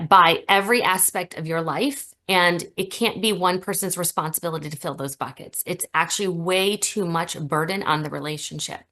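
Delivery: ordinary talk, nothing marked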